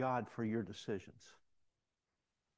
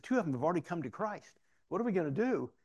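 high, eager imitation of the inmate; animated; 6 semitones higher, faster than usual. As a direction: plead excitedly on behalf of new converts. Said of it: "he becomes so animated".

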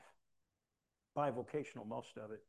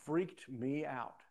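ordinary talk

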